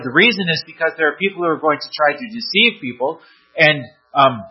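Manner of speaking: usual speaking voice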